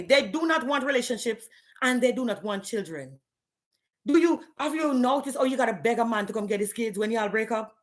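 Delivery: usual speaking voice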